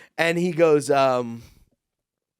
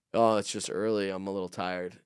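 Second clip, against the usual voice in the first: softer, sinking reply as if worn out; tired; 6 semitones lower, 6 dB quieter than usual. Retold or imitated. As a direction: let it drop low and weary. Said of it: "I'm tired, right?".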